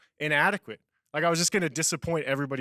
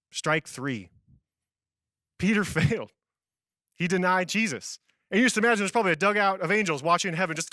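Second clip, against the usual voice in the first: normal talking voice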